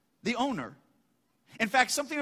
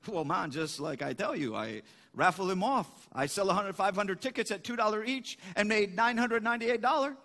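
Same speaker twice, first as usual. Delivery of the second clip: put-on Cajun accent. Boudreaux tells the farmer about his raffle, Cajun accent. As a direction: tell it like a Cajun trader. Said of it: "I won't get the right accent".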